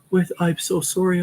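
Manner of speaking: put-on contrite recruit; sheepish apology. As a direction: squeak out an apology.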